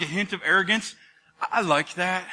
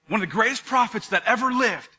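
normal talking voice

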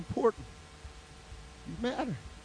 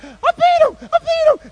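very high, loud victory cry; hammed-up shrieking celebration; triumphant squeal; 16 dB louder than usual. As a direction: squeal it like an overjoyed kid. Boy celebrates his win.

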